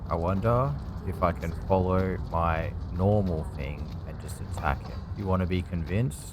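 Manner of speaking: ordinary delivery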